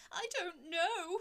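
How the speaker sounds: loud, high, slow sobbing words. A narrator's reading. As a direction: cry it out, voice high and breaking. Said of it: "he sobbed".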